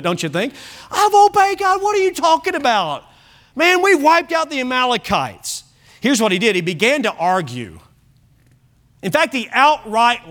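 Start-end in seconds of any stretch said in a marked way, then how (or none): 0.9-5.6 high, whiny, defensive protest; squealing protest. Retold or imitated. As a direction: squeal like a man caught out. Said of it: "He probably squealed a little bit".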